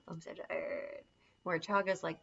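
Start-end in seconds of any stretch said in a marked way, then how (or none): 0.0-1.0 soft, drained mock groan; suddenly deflated, hushed; mock exhausted crash. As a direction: let the energy collapse, acting worn out. Acts out the crash after caffeine.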